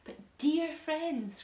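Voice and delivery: high, slow, kindly reassurance; forced cheer. A narrator's reading. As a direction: cheer the shaken friends up. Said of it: "trying to be cheerful".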